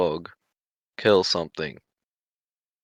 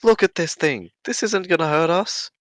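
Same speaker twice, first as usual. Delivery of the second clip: higher-pitched, insistent in-character plea; indignant protest; 8 semitones higher, 3 dB louder than usual. Acted. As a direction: argue urgently that it is harmless. Arguing the creature is harmless.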